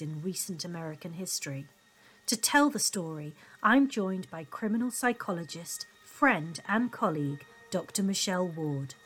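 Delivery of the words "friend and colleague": usual speaking voice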